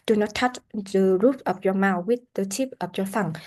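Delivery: ordinary delivery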